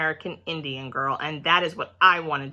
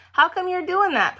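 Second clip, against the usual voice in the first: put-on much higher, eager little boy; curious boy; 12 semitones higher, 4 dB louder than usual. A narrator's reading. Boy quizzing the principal, high eager voice. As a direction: pipe up like a nosy kid. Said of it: "Sheldon asks principal".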